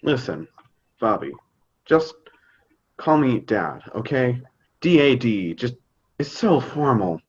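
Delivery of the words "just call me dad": ordinary talk, nothing marked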